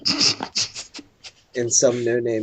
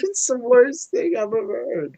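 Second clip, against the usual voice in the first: higher, exasperated wail; 10 semitones higher than usual. Real, not acted. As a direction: whine about the awful song. Despairing over the bad song.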